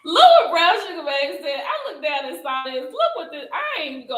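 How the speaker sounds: high, squeaky words through laughter; laughing while talking. Real, not acted. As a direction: try to talk while cracking up. Cracking up over a comment.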